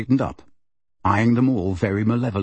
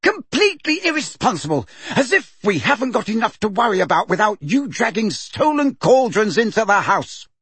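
furious scolding; 10 semitones higher, faster than usual. A narrator's reading. Mother scolding the one who brought stolen cauldrons, high and fast. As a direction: rattle off the scolding at a high-pitched shout. Mrs Weasley keeps shouting.

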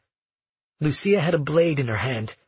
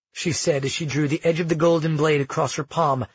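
ordinary delivery

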